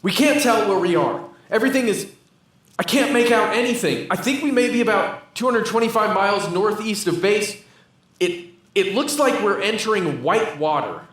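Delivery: desperate and lost radio call; panicked. Acted. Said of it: "even more panicked".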